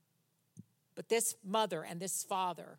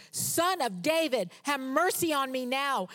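louder, higher plea for mercy; shouting more; 5 semitones higher, 5 dB louder than usual. Retold or imitated. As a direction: shout it even harder. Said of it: "he kept shouting all the more".

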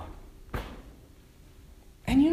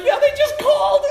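laughing reply; 8 dB louder than usual. Retold or imitated. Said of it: "I'm like".

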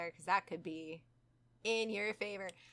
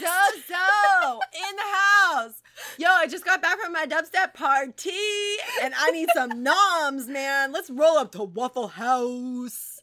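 loud, slow, high dude-bro drawl; party bro voice; 7 semitones higher, 12 dB louder, slower than usual. Pretend. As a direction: play the demon as a hungry party bro.

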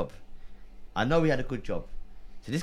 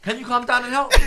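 high, loud, laughing plea; louder exasperated plea to the neighbours; exasperated yell; 12 semitones higher, 10 dB louder than usual. Retold or imitated. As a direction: shout for help, half laughing. Pleading shout at the neighbours.

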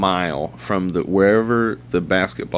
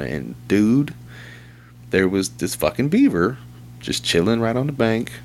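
usual speaking voice

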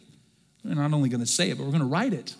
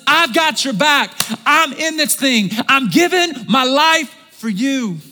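loud, high, drawn-out preaching; fervent pulpit proclamation, slow and loud; preaching at full force; 7 semitones higher, 6 dB louder than usual. Acted. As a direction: build it up and hammer it home. Preaching on three powerful words.

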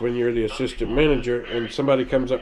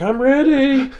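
high startled yelp; startled customer; 12 semitones higher, 6 dB louder, slower than usual. Retold or imitated. Customer startled by a fart, high yelp. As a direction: jump at the sound. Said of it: "they're like".

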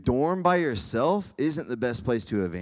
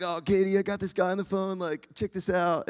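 higher-pitched, playful call to share a prank; retold eager call; 6 semitones higher than usual. Husband calling his wife over during prank call, mock excited. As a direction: imitate yourself eagerly calling someone over.

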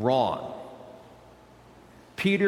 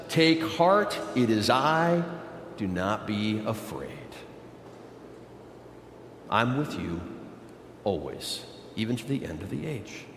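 soft, much quieter, tender reassurance; gentle Jesus; 6 semitones lower, 6 dB quieter than usual. Acted. Jesus calming his scared disciples on the boat, softly tender. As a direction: hush it down like comforting a frightened friend.

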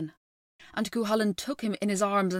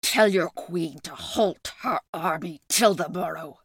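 beast man snarl, louder; 4 dB louder than usual. A narrator's reading. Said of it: "snarled the beast".

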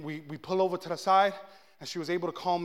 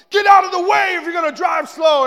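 loud, high road-rage yell; imitating angry driver; 12 semitones higher, 16 dB louder than usual. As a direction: bellow it across to the next car. Angry driver yells at him.

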